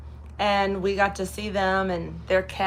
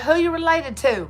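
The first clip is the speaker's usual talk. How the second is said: a high curious small-town lady; florist's voice; 8 semitones higher, 3 dB louder than usual. Retold or imitated. Florist asking the niece who her kin are, high and curious. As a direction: ask it pitched high like a nosy local. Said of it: "She said".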